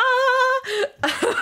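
bright, high laugh breaking out; high burst of laughter. Real, not acted. Laughs mid-reading at her own line.

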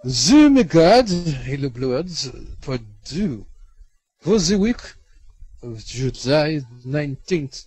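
slow, big announcer intro. Acted. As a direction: boom out the show title like a host. Podcast intro announcement read.

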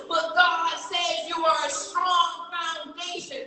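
high, fervent preaching to the congregation. Acted. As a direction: preach the lesson with full conviction. Preacher drives the moral home.

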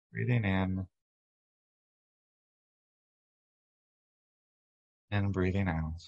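unhurried, even meditation voice with long pauses; steady, calming body-scan delivery; guided meditation. Real, not acted. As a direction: guide listeners gently through breath and body.

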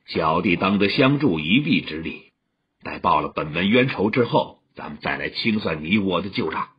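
low-voiced wronged junior brother; grim junior brother. A narrator's reading. Junior brother offers his senior sister a truce, low and grim. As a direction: voice him low, holding the grudge.

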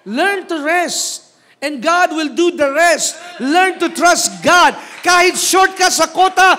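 high, loud, impassioned exhortation; full-force preaching. Real, not acted. Preacher pleading with church to trust God, shouted preaching.

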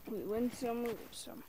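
quiet shrugging aside to himself; soft resigned repeat. Shown as a demonstration. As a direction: let it fall away under your breath.

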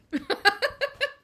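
big high burst of real laughter; loud real laugh. Real, not acted. Quilter cracking up at her misspelled quilt, loud high laugh.

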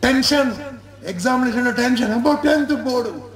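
high, hammed-up nervous exclamations; mock exam tension. Student facing tenth board exam, high mock panic. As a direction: play up the dread of the exam.

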